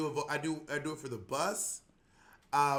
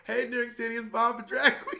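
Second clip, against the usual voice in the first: higher, louder cheery greeting with a laugh; announcement voice; 9 semitones higher, 6 dB louder than usual. Retold or imitated. Bob the Drag Queen imagining his friend hearing him on the subway, cheery and laughing. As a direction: beam it out like a platform announcer. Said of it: "hear me go".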